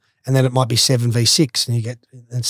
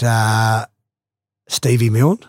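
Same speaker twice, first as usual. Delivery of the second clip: normal talking voice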